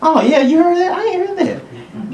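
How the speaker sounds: high-pitched comic disbelief; mock disbelief. Test-taker who missed the tone, high mock disbelief. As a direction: act baffled at what they heard. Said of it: "I was like".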